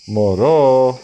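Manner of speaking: put-on a loud startled baby? from the owl; the owl, taken aback, exclaims; surprised owl. A narrator's reading. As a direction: gasp the word out in surprise. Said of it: "είπε έκπληκτη η κουκουβάγια".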